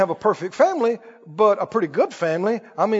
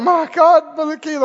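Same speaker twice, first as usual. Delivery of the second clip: higher, hammed-up tearful cry; mock crying; 6 semitones higher than usual. Weeping visitor appealing to the minister, mock crying. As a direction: imitate a weeping, desperate plea. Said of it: "they're, they're crying".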